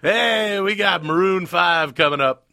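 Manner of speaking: loud upbeat DJ tease; radio DJ voice. Pretend. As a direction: hype the next song like a DJ. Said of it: "Go back to the radio thing".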